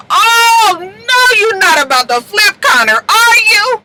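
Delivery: loud, very high mock-horrified cry; dramatic disbelief. Recapper reacting to the DA maybe flipping Connor, dramatic disbelief. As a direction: wail it in exaggerated alarm. Her own alarmed reaction to plot.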